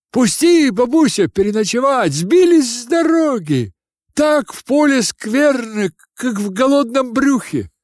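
high, loud wheedling voice; pleading student. Acted. Philosopher begging an old woman for a night's lodging, high-pitched plea.

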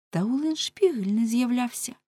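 plain reading voice

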